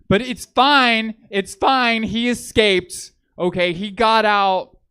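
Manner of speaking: loud, high, drawn-out insistence; insistent reassurance. Acted. Comedian to an uneasy crowd after the camp joke, loud insistent reassurance.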